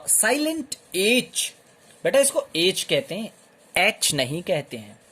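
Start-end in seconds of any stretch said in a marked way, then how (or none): 0.1-1.5 silent H drawn out slowly and high; slow stress. Shown as a demonstration. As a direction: stretch out silent H for emphasis. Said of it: "a word beginning with a".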